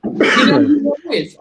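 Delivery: loud, slow boastful taunt; show-off challenge, played up; mock brag. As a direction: drag it out like a cocky local. Retold bragging at pub.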